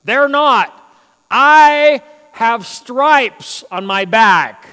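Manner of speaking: Paul stakes his claim, slow and loud on the stripes; indignant Paul. Acted. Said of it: "Paul's going".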